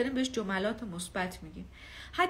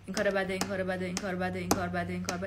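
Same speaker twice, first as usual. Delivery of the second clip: low, monotone repetitive nagging; nagging inner voice; 4 semitones lower, faster than usual. Acted. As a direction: drone it on a loop, low and insistent. (صدای درونیِ سرزنشگر، بم و تکراری)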